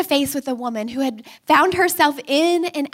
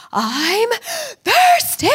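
put-on high, louder whiny little-boy voice; child's complaint; 9 semitones higher, 5 dB louder, slower than usual. Son to mom in the car, high whiny child voice. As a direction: whine it like a thirsty toddler. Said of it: "And he's like".

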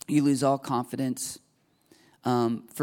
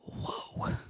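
breathless stunned whisper; a hushed "whoa" as if shattered; awed whisper; 11 dB quieter than usual. Pretend. Believer seeing their own sin, hushed awe.

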